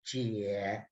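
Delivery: "Jie" dipped far down in pitch and very soft; low third tone. Shown as a demonstration. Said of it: "third tone. So it's very low".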